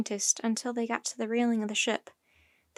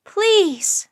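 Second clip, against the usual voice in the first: high, loud screamed plea; desperate high-pitched cry; screaming; 9 semitones higher, 10 dB louder than usual. A narrator's reading. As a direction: scream it, begging. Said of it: "screamed Annie".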